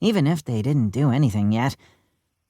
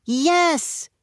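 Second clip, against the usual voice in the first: mock shout of eager schoolgirls; girls shouting; 12 semitones higher than usual. A narrator's reading. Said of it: "shouted all the girls".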